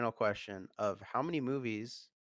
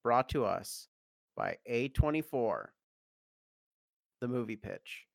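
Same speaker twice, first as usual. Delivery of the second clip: podcast announcer; 5 dB louder, slower than usual. Acted. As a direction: announce it like a show host. Host introduces sponsored segment.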